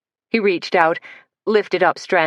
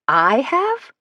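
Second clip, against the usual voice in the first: surprised; 10 semitones higher than usual. Acted. Surprised reply in argument.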